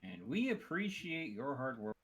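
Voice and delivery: slow, drawn-out corporate announcer voice; Aperture Science announcer. Pretend. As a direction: thank the staff like a facility announcer, slowly. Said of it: "sound like Aperture Science announcer".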